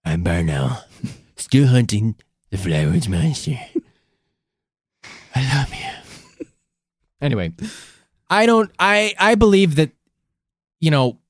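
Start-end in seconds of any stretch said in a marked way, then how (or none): 0.0-6.4 low, slow, mysterious persona; deep character voice. Pretend. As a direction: drop low and drag it out.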